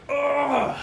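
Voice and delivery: high, loud retold groan of disgust; disgusted ugh. Teller reacting to claw marks inside the casket, high loud ugh. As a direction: recoil with a big ugh. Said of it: "I'm like".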